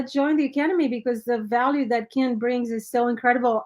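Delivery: ordinary talk, nothing marked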